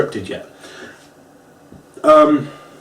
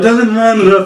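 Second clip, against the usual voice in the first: chair-swing grunts; 8 semitones higher, 5 dB louder than usual. Retold or imitated. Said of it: "Sheamus is just like".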